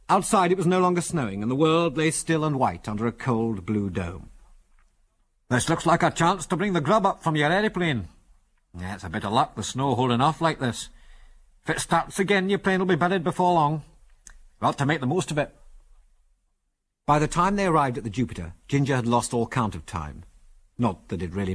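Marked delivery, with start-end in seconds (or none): 5.5-15.6 put-on old Scotsman, broad Scots accent; broad Scots character voice; strong Scots accent. A narrator's reading. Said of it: "in a strong Scots accent".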